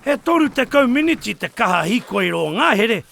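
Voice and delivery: ordinary talk, nothing marked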